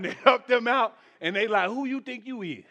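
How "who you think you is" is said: much softer, sneering comeback; mocking a rude retort. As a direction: toss it back with attitude. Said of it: "That's how they say".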